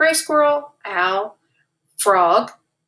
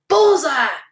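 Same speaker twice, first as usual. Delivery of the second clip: loud, bright little cheer; the young skunk cheers his hit on target, loud and pleased; 5 semitones higher, 5 dB louder than usual. A narrator's reading. A proud shout of triumph. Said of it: "cheered Sassafras".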